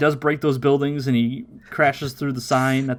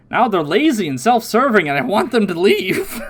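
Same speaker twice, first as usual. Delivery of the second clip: high, louder put-on grievance; whiny mock complaint; 7 semitones higher, 4 dB louder than usual. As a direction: play an exasperated cartoon character complaining. Voicing Oolong's complaint about the maids.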